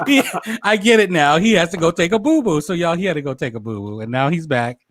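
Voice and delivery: usual speaking voice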